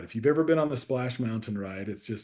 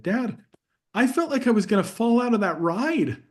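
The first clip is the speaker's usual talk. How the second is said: higher, lightly voiced young girl; daughter's voice; 9 semitones higher, 4 dB louder than usual. Dad quoting his scared youngest daughter, higher child voice. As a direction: play the little daughter telling dad her fear. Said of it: "and then she said".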